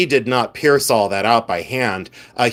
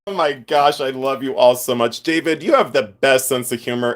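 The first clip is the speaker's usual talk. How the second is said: usual speaking voice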